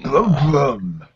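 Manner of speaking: lightly imitated motoring-presenter engine noise; hint of a TV car-show host impression; Jeremy Clarkson. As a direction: join in with a mock car rev. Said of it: "in a Jeremy Clarkson accent as well".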